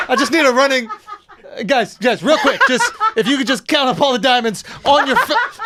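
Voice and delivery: loud, laughing mock Indian accent; hammed-up Indian accent through laughter.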